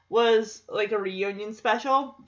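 ordinary talk

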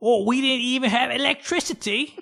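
very high-pitched comic English voice; mock British accent. English newcomer telling of her backward home, mock British accent. Acting the woman from backward England.

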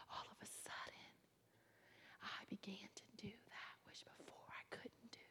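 hushed whisper. Real, not acted. Whispered testimony.

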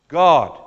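God hit hard and loud after a pause; punched final word. Acted. Preacher landing the last word of the verse, loud punch.

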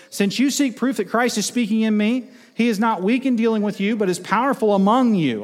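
high, loud preaching read; forceful scripture reading. Acted. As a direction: proclaim the verse with full force.

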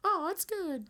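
very high mock sister-in-law voice. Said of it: "She's like".

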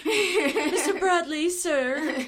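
high, loud, drawn-out mock reverence; playfully overdone formal greeting; mock-deferential address. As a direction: hail him with exaggerated fan respect. Mock-deferential replay of her address.

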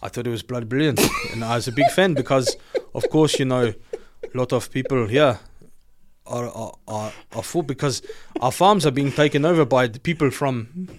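mock South African accent, deadpan. Shown as a demonstration. Answering a chat question, confident South African accent. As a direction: give an opinion in a put-on South African accent. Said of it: "I'll do my South African accent".

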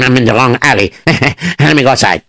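plain reading voice

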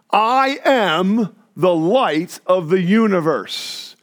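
slow, weighty proclamation with strong beats; grandiose claim. Acted. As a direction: proclaim it like an outrageous cosmic claim. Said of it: "I'll tell you who talks like this".

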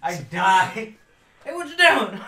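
ordinary talk, nothing marked

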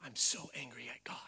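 secretive hushed whisper. Retold or imitated. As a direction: repeat it under your breath. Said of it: "and they'll whisper".

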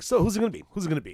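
eager asking. Retold or imitated. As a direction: hype it up, keen to know. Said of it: "first they were like".

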